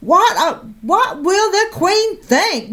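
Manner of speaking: high, slowed outcry; scandalised exclaim; crying out. Acted. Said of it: "Missus McSnoot cried".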